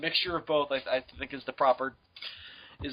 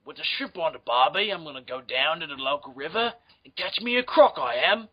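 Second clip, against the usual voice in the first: lightly mock Australian; 3 semitones lower than usual.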